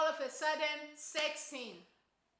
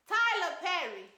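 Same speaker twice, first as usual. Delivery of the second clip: normal talking voice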